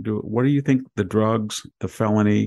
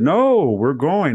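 loud, slow, higher insistence; retold refusal; 6 semitones higher, 5 dB louder, slower than usual. Friends refusing to let the teen out, slow loud insistence. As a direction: overrule him, drawn out.

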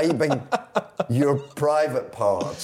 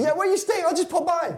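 much higher, hammed-up Italian fan voice; mock Italian fan. [name] mimicking the Italian fan dropping by, mock Italian accent. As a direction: play the eager fan turning up uninvited. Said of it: "when you come into Italy".

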